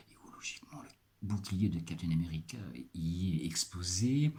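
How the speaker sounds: a sly whispered joke; whispered aside. Acted. Presenter whispering a joke aside to viewers. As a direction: drop to a whisper like sharing a secret. Joking aside about Captain America.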